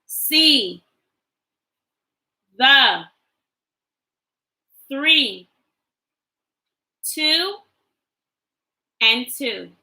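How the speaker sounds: put-on slow, clear model reading for kids to echo. Shown as a demonstration. Teacher reading sight words aloud for the class to repeat, slow and clear. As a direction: say each word alone, then pause.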